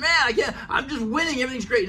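a quick mock boast; mock sudden cheer. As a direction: pretend you're suddenly winning. Mock sudden self-talk.

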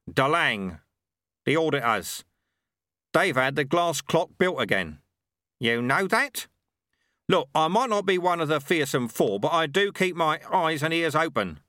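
a proud tradesman talking shop; Ronnie the milkman. Acted. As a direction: Ronnie the milkman, pleased with his product. Ronnie on the auditors.

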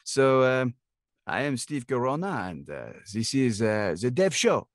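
put-on French accent with drawn-out words. Shown as a demonstration. Guest doing the intro on the host's request, French accent. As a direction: play a Frenchman introducing the show. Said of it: "give me the French then".

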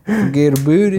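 mock New York accent, rising at the end; a playful fake New York tough-guy line; New York Cajun accent. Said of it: "I speak New York Cajun".

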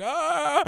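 high-pitched mock yell; retold scream. Retelling own fright to a friend, high mock scream. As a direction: cry out in mock alarm.

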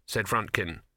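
ordinary delivery

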